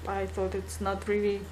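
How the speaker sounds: normal talking voice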